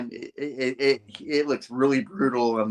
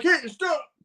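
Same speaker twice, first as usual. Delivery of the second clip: guard shouting. Acted. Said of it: "they're like".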